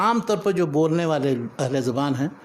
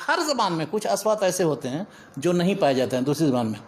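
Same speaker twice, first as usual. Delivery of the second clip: ordinary talk, nothing marked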